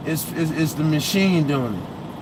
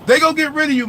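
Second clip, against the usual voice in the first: repeated much higher and louder; emphatic warning; 10 semitones higher, 4 dB louder, faster than usual. Real, not acted. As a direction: drive the warning home, rising up.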